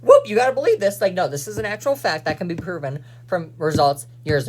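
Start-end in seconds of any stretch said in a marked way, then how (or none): 0.0-1.0 mocking a glib know-it-all, higher and louder; mock breezy quote. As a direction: toss it off like a fake know-it-all. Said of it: "and saying".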